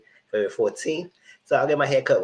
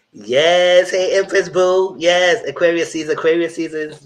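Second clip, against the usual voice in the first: loud, high, hyped shout-out; excited cheering; 7 semitones higher, 11 dB louder than usual. Real, not acted. Host hailing a viewer over Aquarius season, loud and excited.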